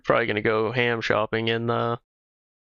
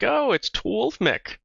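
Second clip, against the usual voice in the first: surprised; 9 semitones higher, slower than usual. Retold or imitated. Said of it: "I was like".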